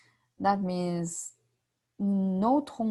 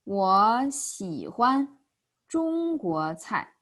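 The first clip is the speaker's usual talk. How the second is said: 我喜欢 中国菜 stretched out slowly; slow example sentence; slower than usual. Shown as a demonstration. Teacher gives the next example, slow and drawn out. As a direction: say each syllable slowly and carefully. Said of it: "Next example is".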